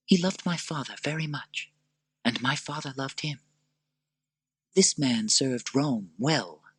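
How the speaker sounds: tender Lucilla. Acted. Lucilla reminding Maximus of his past, soft. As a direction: recall him gently.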